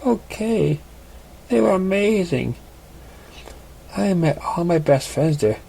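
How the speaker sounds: put-on cheerful old friend catching up; gentle female pony voice; young mare. Female character voice.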